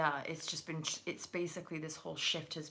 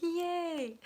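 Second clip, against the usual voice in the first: much higher, louder squeal of joy; mock cheer; 13 semitones higher, 5 dB louder than usual. Reader cheering for happy couples, high excited squeal. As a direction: cheer it like a delighted fan. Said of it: "I'm like".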